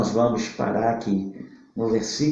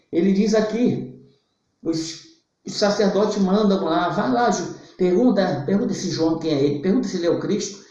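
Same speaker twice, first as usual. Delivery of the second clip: lively preacher retelling scripture, higher pitch; animated Bible storytelling; 7 semitones higher than usual. Acted. Preacher retelling the priests' questions to John, animated storytelling. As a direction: tell the story with rising energy.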